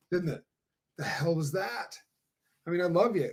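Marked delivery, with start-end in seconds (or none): none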